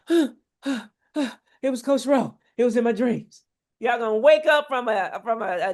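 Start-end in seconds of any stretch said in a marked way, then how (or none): 0.1-3.4 slow, spooked waking gasp voice; startled dreamer. Pretend. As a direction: act a follower jolted awake from a nightmare.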